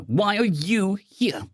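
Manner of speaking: high, slow, teasing question; grinning. A narrator's reading. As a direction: ask it through a grin. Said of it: "I grinned".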